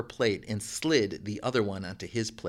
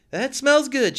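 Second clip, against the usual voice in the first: voiced high and louder, a bit forced; a polite brush-off, higher and louder; woman's voice; 7 dB louder, slower than usual. A narrator's reading. Woman character refusing dinner.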